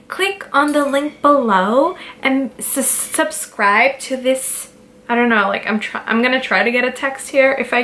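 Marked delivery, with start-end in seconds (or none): 0.1-4.7 mock YouTuber voice. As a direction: ham up a bubbly YouTuber sign-off. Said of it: "what do, like, these YouTubers do?".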